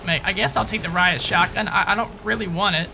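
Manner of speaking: usual speaking voice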